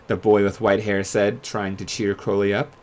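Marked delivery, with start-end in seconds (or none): none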